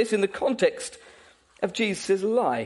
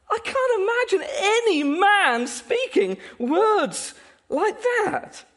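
high, slow, emphatic disbelief; incredulous; 9 semitones higher, slower than usual. Real, not acted. Preacher marvels at Jesus' words.

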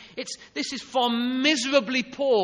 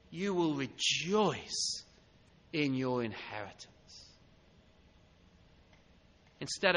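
soft reassurance; 8 semitones lower, 6 dB quieter than usual. Acted. Softly assuring the congregation.

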